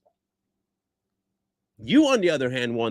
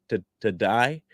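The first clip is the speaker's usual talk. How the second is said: ordinary talk, nothing marked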